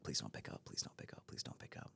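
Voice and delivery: nervous prayer under the breath; hoping Michael won't answer, whispered; fading anxious whisper. Retold or imitated. Hoping the call isn't answered.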